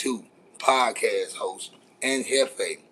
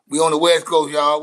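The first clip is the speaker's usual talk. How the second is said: calling out; 3 semitones higher, 7 dB louder than usual. Real, not acted. Calling out to West Coast viewers.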